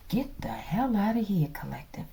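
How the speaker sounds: whispered dismissal; hushed get-out-of-here aside. Real, not acted. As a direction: breathe it out under your breath.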